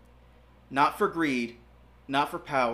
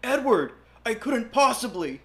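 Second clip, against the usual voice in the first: protesting; 7 semitones higher, 5 dB louder than usual. Acted. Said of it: "Alexander protested".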